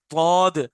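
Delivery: तौद said with a heavy, full Arabic d; demo letter sound. Shown as a demonstration. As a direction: show the heavy letter clearly. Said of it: "आप खुद देखिए".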